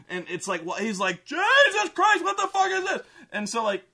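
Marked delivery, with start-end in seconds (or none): 1.2-3.0 very high, loud mock shriek; panicked shriek. Man faces the screaming kid, panicked shriek. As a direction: shriek it out in hammed-up terror.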